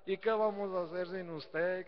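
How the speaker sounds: high, whiny fake lament; mock weeping parishioner. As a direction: play the sobbing woman who only pretends grief. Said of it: "llora y".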